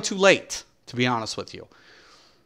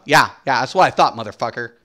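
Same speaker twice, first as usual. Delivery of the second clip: ordinary talk, nothing marked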